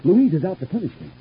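high, agitated grievance; paranoid outburst. Acted. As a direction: insist that she is out to get you. Paranoid outburst about sister-in-law.

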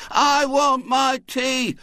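high, very slow, loud sing-song chant; children chorusing. A narrator's reading. The party guests chanting together for their tea. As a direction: chant it together like a crowd of hungry kids. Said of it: "chorused the other guests".

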